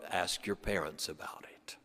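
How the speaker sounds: trailing off into a soft whisper. Retold or imitated. German man sends schoolchildren to their parents, whispering. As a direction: let it fade to a whisper. Roman ends solemn point.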